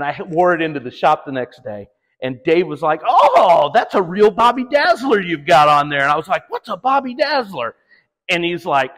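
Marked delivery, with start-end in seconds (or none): 3.0-6.0 loud, high, hammed-up admiration; mock admiring exclamation. Acting out host admiring new hoodie. 6.5-7.8 high-pitched baffled question, lightly acted; puzzled query starting high and falling; mock puzzlement. Acting out own puzzled reply to host.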